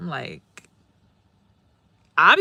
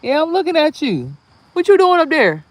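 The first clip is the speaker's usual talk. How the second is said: higher, louder call up the tree; 9 semitones higher, 10 dB louder than usual. Real, not acted. Vlogger calling up to a squirrel in the tree, loud playful shout.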